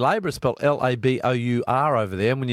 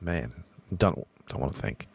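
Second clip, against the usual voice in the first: much lower, trailing off quietly; muttered dread; 8 semitones lower, 8 dB quieter than usual. Real, not acted. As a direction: drop away, not wanting to think. Dreading Trump term.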